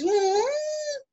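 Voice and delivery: high-pitched drawn-out "hmm"; disbelieving hum. Pretend. Child answers mother's request for water, high incredulous hum. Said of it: "você tá achando meio que absurdo".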